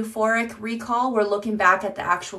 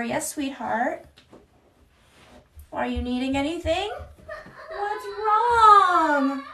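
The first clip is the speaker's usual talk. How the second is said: sweet mom reply; 8 semitones higher, slower than usual. Real, not acted. Mom answers her toddler, slow and tender.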